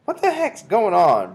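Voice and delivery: high, loud, drawn-out question; puzzled boy. A narrator's reading. Bruno questions the witches, high and puzzled. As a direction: ask as a put-out bratty boy. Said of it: "Bruno asked".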